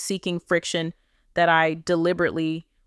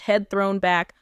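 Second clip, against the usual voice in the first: ordinary delivery, nothing marked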